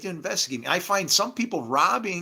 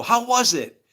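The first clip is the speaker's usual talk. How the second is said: high, louder, eager question; 7 semitones higher, 6 dB louder than usual. Acted. Friend asking him on waking from the simulation, eager. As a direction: pitch it up like an excited friend.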